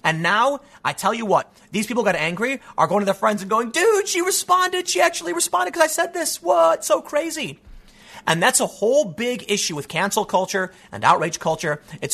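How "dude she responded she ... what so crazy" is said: hammed-up, squeaky disbelief; mock excited gushing. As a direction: imitate a thrilled fan bragging to friends. Mimics attention seekers telling their friends.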